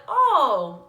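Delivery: high, loud exaggerated "Oh"; mock surprised oh. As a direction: imitate a shocked, judging reaction. People reacting to her news.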